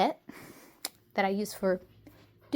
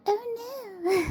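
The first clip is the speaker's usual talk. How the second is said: high squeaky mock dismay; 11 semitones higher than usual. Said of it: "So I was like".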